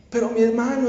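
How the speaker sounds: ordinary talk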